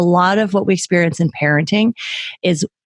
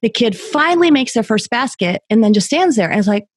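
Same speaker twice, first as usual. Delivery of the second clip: ordinary talk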